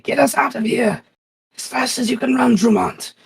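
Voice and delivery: high, crinkly, desperate gasping voice; paper creature voice. Acted. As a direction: gasp it out like crumpled paper. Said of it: "kinda crinkly voice".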